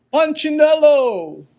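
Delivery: booming welcome call; strong call. Acted. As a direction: call out to him across the workshop. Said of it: "The voice was deep and strong".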